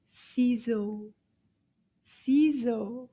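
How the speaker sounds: normal talking voice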